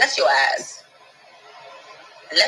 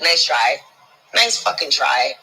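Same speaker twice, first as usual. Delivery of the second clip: ordinary delivery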